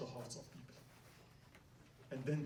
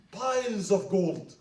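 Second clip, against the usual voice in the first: awed; 6 semitones higher, 11 dB louder, slower than usual. Acted. Marvelling at church's wealth.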